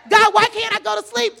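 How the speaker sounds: rally the crowd to find gold; preaching at full force. Acted. Preacher exhorting the congregation, shouted preaching. Sermon climax.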